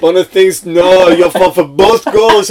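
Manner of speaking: loud, high mimicked complaint, stretched at the end; put-on protesting yell; Jason Scotland impression. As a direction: shout the accusation as a teammate. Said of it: "then he got Jason Scotland saying".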